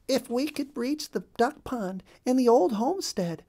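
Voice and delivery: high, hopeful mother duck voice; hopeful wife. A narrator's reading. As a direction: lift the voice, longing for home. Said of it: "said Missus Mallard".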